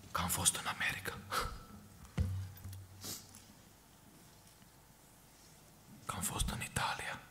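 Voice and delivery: hushed, breathy confiding whisper; whispered aside. Real, not acted. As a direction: lean in and whisper it like a secret. Preacher drops to confiding aside.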